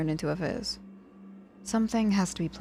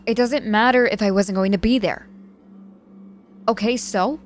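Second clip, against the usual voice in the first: louder and higher, insisting; exasperated protest; 6 semitones higher, 7 dB louder than usual. Acted. Patient insisting friends should have partied, loud exasperation. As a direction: argue it hard, fed up.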